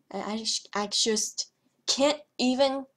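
ordinary talk, nothing marked

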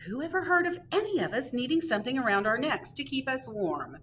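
a mild hint of a fussing mother. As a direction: rattle it off like a fretting mother. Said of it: "his mother fussed".

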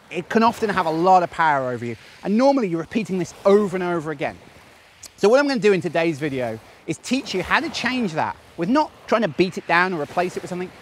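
ordinary talk

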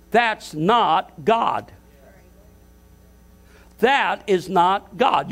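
very slow, each word weighed and spaced; slow emphatic denial. Real, not acted. Pastor rejecting the TV preacher's plea, slow and emphatic. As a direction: lay down every word like a verdict. Pastor denounces the fundraising appeal.

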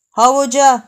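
gleeful jab. Acted.

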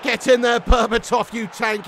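loud high yell at the goal; goal celebration shout. Real, not acted.

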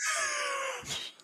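very high-pitched burst of laughter; helpless high squeal of laughter; real cracked-up cackle; shrieking laugh. Real, not acted. Cracks up at the comparison.

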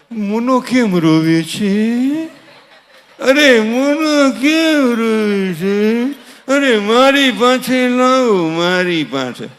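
long drawn-out cooing sing-song; fussing over baby. Acted. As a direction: stretch every word as if soothing a crying baby. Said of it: "મમ્મીને હક ન થાય". (લંબાવેલા લહેકાથી બાળકને લાડ)

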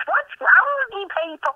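usual speaking voice

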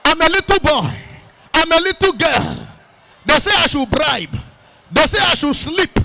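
lightly voiced pleas of the young; voicing the afflicted. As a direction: speak as the troubled child crying out.

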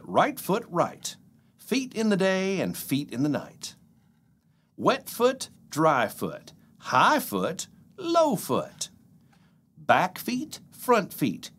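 sing-song rhyming read-aloud; playful picture-book reading; reading to children. Acted. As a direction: bounce through the rhymes for little kids. Reads The Foot Book aloud.

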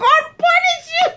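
high-pitched mock outburst of indignation; retold curse, voice shooting up high; outraged sister. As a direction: imitate a scandalized sister cursing someone.

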